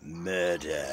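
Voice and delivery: very low, slow, grave voice; Richard Burton impression. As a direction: intone it deep and dramatic. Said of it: "I can do Richard Burton".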